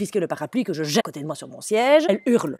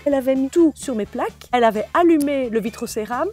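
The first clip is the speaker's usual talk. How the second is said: normal talking voice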